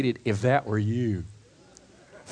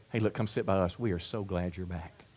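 soft, quiet, kindly invitation; a hushed friendly welcome; gentle welcome; 7 semitones lower, 8 dB quieter than usual. Acted. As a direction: welcome him back tenderly. Welcoming a returning backslider.

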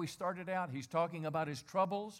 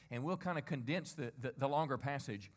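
usual speaking voice

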